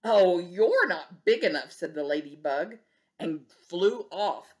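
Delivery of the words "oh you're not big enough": louder scornful brush-off; grouchy bug excusing itself; grouchy dismissal. Acted. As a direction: back down with a sneer. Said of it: "grouchy dismissal".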